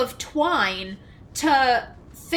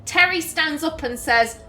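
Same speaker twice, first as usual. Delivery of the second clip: ordinary talk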